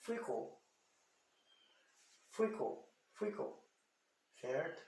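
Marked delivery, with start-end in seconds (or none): 0.0-3.5 soft, spaced takes of "frickle" with short closed vowel. Shown as a demonstration. Teacher drilling the closed E, quiet repeated "frickle". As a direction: repeat "frickle" softly as a whole word. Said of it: "um Ezinho fechado".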